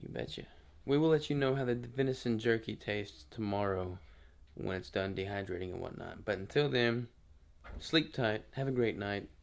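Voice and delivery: soft late-night whisper; hushed goodnight sign-off. Real, not acted. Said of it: "I'm whispering 'cause Crystal's sleeping".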